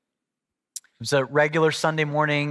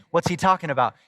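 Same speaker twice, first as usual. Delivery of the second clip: normal talking voice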